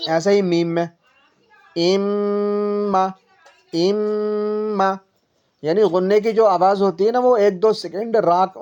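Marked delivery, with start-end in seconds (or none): 1.8-4.9 اما with the م held long through the nose, very slow; nasal ghunna. Shown as a demonstration. Teacher models the held nasal م in اما. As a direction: hum the م of اما for two seconds. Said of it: "ناک میں روک کر کے ہم جب پڑھتے ہیں".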